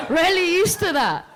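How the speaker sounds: giggling admission; laughing. Real, not acted. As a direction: break into a laugh mid-line. Laughing at own remark.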